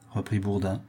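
ordinary delivery

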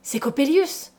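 name him with rising dread; mother's startled, fearful realization; alarmed, turning pale. A narrator's reading. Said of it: "dit ma mère en pâlissant".

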